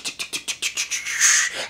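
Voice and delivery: whispered clicking of lighters; voiced imitation of lighters clicking; lighter clicks. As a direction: tick off the lighters softly. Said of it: "twenty arms going tick".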